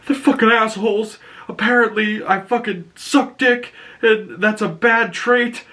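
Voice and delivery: a whiny mock rant about the insult; sulky Peacemaker. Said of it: "And he's like".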